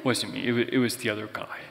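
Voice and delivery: lightly voiced old-man puppy, low and soft, rushed at the end; a hint of a guilty old puppy pleading innocence. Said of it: "old man puppy voice".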